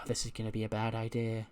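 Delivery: soft, weary sigh before the words; mock resigned sigh, quietly voiced; sighing resignation. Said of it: "Cammy just sighs and goes".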